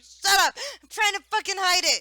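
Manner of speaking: high, loud outburst; exasperated shout. Retold or imitated. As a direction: yell it back defensively at a teaser. Acting out her comeback when teased.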